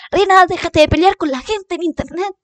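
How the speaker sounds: imitating her mom yelling from her bedroom. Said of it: "me grita de su pieza". (mamá gritando desde su pieza a Rina)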